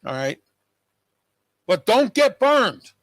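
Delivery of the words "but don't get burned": slow, high, loud and pressing; urgent warning. Real, not acted. Host warning his viewers, slow loud urgent plea. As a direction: lean in and drive the warning home.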